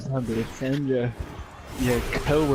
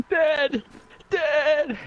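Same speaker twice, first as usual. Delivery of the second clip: high-pitched call, volume held back; restrained mock scream; 4 dB louder than usual. Friend screaming for Dad as zombies break in, restrained. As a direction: yell for help without full volume. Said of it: "You're, like, screaming it".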